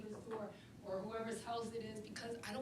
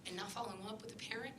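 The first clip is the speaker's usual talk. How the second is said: normal talking voice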